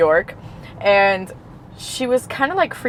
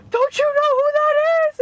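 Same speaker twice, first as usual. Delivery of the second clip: high, incredulous friend's voice; amazed friend. Retold or imitated. Friend telling Athena who the host is, high incredulous voice. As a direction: squeal it like she can't believe you don't know.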